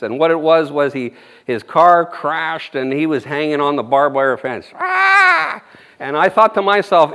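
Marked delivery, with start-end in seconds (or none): 4.7-5.6 imitated scream. Crash victim's scream.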